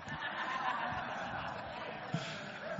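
soft high airy laugh; a real chuckle under the breath; breathy laugh. Real, not acted. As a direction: laugh it off quietly. Speaker laughs at own point.

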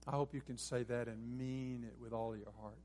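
ordinary talk

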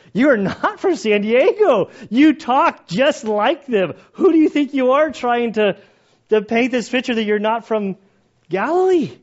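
loud high accusation; accusing shout. Retold or imitated. Bystander shouting at Peter, loud and high. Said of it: "He's like".

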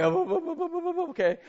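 a hammed-up jittery witness stalling; imitating his shaky teenage self on the stand; nervous stammer. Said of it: "It's like".

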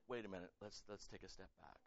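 soft, quiet calming voice; gentle. Pretend. God gently calming the angry pastor, soft and quiet. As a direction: ease him back gently. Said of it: "gently and prodded me".

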